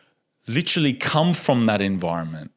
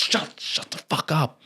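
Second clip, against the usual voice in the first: urgent whisper; 5 semitones higher, 5 dB quieter than usual. Retold or imitated. Hushing her near the predator.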